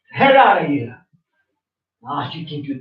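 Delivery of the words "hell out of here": high, angry dismissal; real quarrel, voice pitched up; angry outburst. Real, not acted. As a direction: throw them out with a raised, angry voice. Real family quarrel, ordering out.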